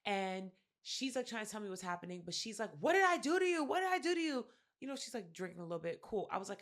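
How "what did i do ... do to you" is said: high, loud imitation of someone wailing; mock bawling, pitched way up; bawling plea. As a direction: act out her tearful protest at high pitch. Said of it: "bawling, crying".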